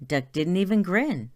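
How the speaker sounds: ordinary delivery, nothing marked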